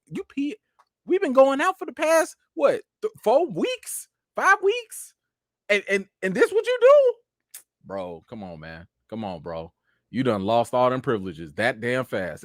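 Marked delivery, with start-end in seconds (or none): 0.1-7.6 much higher, disbelieving scold; can't believe the dog did this; exasperated scolding. Real, not acted. Scolding the dog after peeing. 7.8-9.7 much softer, lower letdown; disappointed. Real, not acted. Disappointed in the dog.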